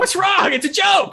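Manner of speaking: high, loud shocked cry of what's wrong; mock protest. Friend clocked over a joke, shouting in mock outrage. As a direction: protest the punch as just a joke, loudly.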